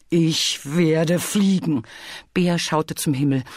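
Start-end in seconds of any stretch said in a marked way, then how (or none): 0.1-1.8 slow, emphatic insistence; determined declaration. Acted. Bear defies Bee's doubts, determined. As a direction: stretch each word with resolve.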